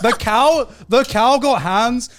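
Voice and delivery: loud, drawn-out punchline; loud comic emphasis. Real, not acted. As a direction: belt out the joke. Joking punchline.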